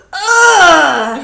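loud, high groan of disgust; drawn-out revolted cry; disgusted ugh. Acted. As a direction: blurt out a hammed-up "ugh". Sully reacts with disgust in story.

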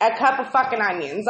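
ordinary delivery

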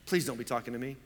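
low, soft pleading to himself; muttered plea. Retold or imitated. Pastor silently begs the shouting neighbor to ignore him, low mutter. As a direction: mutter it under your breath.

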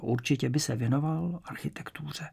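ordinary delivery, nothing marked

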